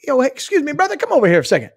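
put-on buddy approach; calling him over. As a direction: flag a brother down for a word. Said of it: "this is the way I would talk".

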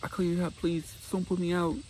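high begging voice, pleading; begging sister. Retold or imitated. Sister begging her brother not to evict her, high pleading. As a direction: beg him like a scared sister. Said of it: "She's like".

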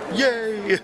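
high, loud laughing yay; bright laughing cheer of delight; excited cheer. Real, not acted. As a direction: cheer her arrival with a laugh. Cheering as Liz arrives.

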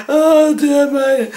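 slow, high, laughing; drawn out through fading laughter; laughing sigh. Real, not acted. Butcher winding down from laughter, slow and high.

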